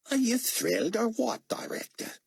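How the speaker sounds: a hint of an Aussie motor pool man; Australian accent. A narrator's reading. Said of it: "his accent making David guess he was an Aussie".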